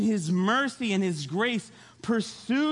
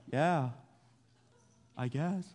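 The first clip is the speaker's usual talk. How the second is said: sheepish admission; 5 semitones lower, 5 dB quieter, slower than usual. Retold or imitated. Jonah's sheepish reply to sailors.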